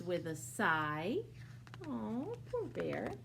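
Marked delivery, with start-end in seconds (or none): none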